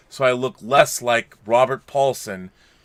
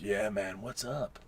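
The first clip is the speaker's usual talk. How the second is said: laid-back mock greeting; 6 semitones lower, 16 dB quieter than usual. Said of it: "We're like".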